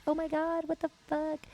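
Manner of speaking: high-pitched imitated alarm; put-on startled character voice; mock freak-out. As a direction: act out a bystander's panic, high. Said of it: "everyone's freaking out".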